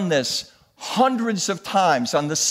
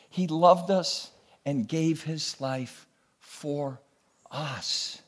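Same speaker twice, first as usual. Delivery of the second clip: soft earnest appeal; 5 dB quieter than usual. Real, not acted. Sermon sinks to a soft appeal.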